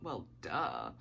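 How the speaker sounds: low, dry "well, duh"; deadpan. Retold or imitated. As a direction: toss it off as obvious. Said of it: "And I was like".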